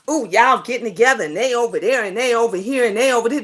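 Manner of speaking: run-on imitation of envious grumbling; mock grumbling. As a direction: rattle it off low like a jealous onlooker. Mocking others' envious complaints.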